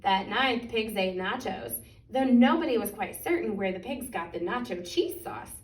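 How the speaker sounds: plain reading voice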